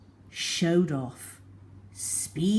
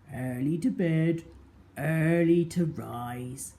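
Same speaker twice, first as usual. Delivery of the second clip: to himself; 2 semitones lower than usual. A narrator's reading. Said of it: "he said to himself".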